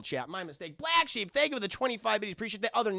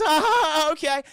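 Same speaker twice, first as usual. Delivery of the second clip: high, loud acted giggly reply; loud put-on agreement, pitched up; Mabel; 10 semitones higher, 8 dB louder, slower than usual. Mabel agreeing to dress up, high and loud. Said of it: "And she goes".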